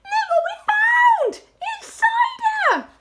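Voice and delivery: put-on very high, loud young fox shouting; excited cry. A narrator's reading. Smallest fox announcing his find, excited shout. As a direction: shout it high, drawing out the last word. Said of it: "he cried out".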